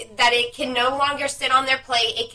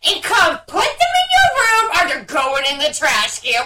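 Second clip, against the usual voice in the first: stern mom yell; 7 semitones higher, 4 dB louder than usual. Retold or imitated. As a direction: lay down the law to a two-year-old. Mom ordering toddler, shouting.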